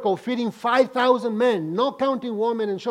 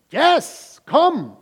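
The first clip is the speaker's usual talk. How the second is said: inviting call; 6 semitones higher, 5 dB louder than usual. Acted. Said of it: "Jesus said".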